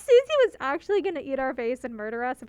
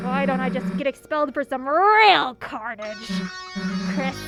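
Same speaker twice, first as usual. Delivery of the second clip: tough bully voice; 2 semitones lower, 4 dB louder than usual. Acted. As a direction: threaten them like a tough bully. Reading Susie's threats in game.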